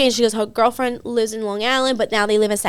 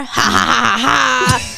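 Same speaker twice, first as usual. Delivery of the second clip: mock flirty laughter; much slower than usual. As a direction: imitate someone laughing along with her. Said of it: "Like, laughing with her".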